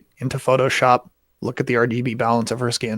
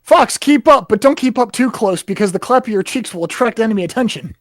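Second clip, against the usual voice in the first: very high cartoon radio yell; Star Fox pilot voice; 9 semitones higher, 3 dB louder than usual. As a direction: shout the classic barrel roll line. Star Fox quotes, laughing shout.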